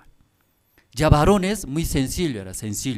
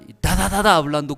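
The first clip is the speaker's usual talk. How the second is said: higher, louder ta ta burst; rapid-fire chatter; 4 semitones higher, 5 dB louder, faster than usual. Shown as a demonstration. Preacher mimicking a wife arguing back. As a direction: fire words like a machine gun. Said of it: "Ya quiere justificar".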